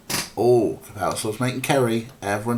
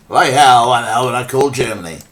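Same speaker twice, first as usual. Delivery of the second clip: loud, scoffing send-up of the hero's refusal; mock Gabriel; 9 dB louder than usual. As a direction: bellow it back at him like a mocking parrot. Mocks Gabriel's refusal to call.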